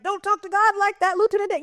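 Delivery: very high, anxious protest; imitating Forrest. Forrest warning Lieutenant Dan not to challenge God, high mimicked alarm. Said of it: "Forrest is like".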